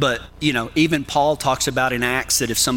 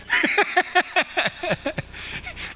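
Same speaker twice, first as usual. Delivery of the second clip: high-pitched burst of laughter; 4 dB quieter than usual. Real, not acted. Preacher cracking up at his own Texas accent joke, high laugh.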